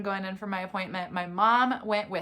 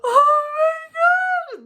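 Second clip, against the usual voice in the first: much higher, louder, very slow, sobbing with joy; crying; 11 dB louder, much slower than usual. Real, not acted. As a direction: let the happy tears break the words. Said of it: "That's when I really started crying".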